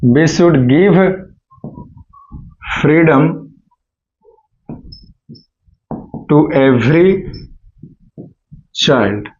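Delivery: very slow and low, word by word; slow dictation. Shown as a demonstration. Teacher dictating the answer slowly to students. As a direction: dictate slowly so they can write. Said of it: "dictating answer slowly".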